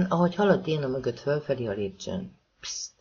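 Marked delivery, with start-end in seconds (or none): 2.6-2.9 soft, sharp shush; an annoyed, hushed "pszt"; cross shushing. A narrator's reading. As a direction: hush him crossly under your breath. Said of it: "Nézett rá Déna mérgesen".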